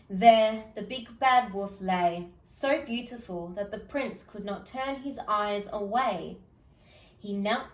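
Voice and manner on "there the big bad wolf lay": ordinary delivery, nothing marked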